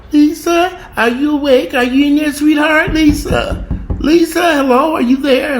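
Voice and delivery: very high, sing-song sweet call. Retold or imitated. Knocking at her sister's door, calling sweetly and very high. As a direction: coax her out with a sugary high voice.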